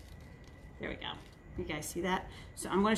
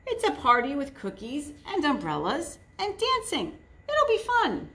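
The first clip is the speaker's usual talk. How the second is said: excited; 6 semitones higher than usual. Acted. Piggy selling the party idea.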